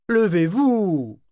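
high, drawn-out imperious order; commanding. A narrator's reading. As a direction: order them up, stretching the words high. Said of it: "ordonna-t-il". (ordre impérieux, aigu et traînant)

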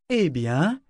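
pleased with oneself; self-satisfied. A narrator's reading. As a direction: declare it with smug satisfaction. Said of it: "déclara-t-il avec satisfaction".

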